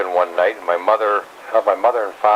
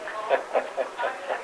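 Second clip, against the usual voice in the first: airy chuckle after the joke; soft laugh; 11 dB quieter than usual. Real, not acted. Grandfather laughing at his wife's beer formula, soft chuckle. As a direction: let a quiet laugh slip out.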